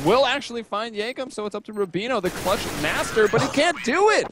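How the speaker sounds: building to a high shout; excited esports caster. Acted. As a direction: hype the clutch call up. Clutch round call, crescendo.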